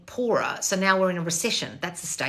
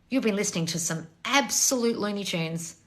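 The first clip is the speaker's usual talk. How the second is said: ordinary talk, nothing marked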